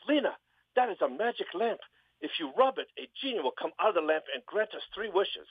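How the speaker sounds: high put-on Scandinavian farmer voice; Sven voice. Sven telling Lena about the magic lamp, high eager voice.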